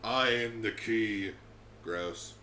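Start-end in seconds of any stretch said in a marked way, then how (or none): 0.0-1.3 villain voice. Pretend. Slow villain echo.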